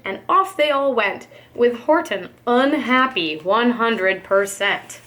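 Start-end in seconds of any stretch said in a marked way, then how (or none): none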